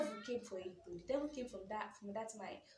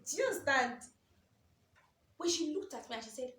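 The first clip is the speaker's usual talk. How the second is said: usual speaking voice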